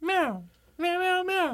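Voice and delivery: high squeaky meows; cat meowing. Retold or imitated. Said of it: "How did it go?".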